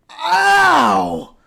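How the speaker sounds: put-on dazed boy yelps at his date, loud and high; pained shout. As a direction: cry out in pain and shock. Kyle wakes after being hit.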